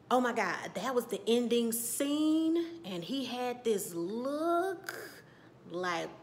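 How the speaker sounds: normal talking voice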